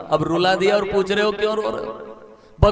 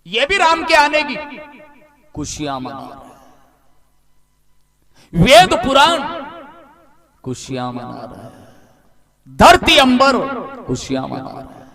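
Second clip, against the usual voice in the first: preaching at full force; 6 semitones higher, 6 dB louder than usual. Acted. Preacher to devotees, rousing the rejoicing refrain. Preacher building the celebration list.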